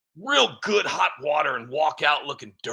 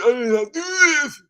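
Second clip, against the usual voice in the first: higher, louder mock plea of innocence; desperate denial; 12 semitones higher, 6 dB louder than usual. [name] swears to the public he is innocent, hammed-up higher plea. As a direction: insist it again, higher and louder.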